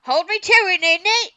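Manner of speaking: high, loud, hammed-up British accent; bad British accent. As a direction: belt it out in a mock British accent. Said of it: "I've heard your British accent".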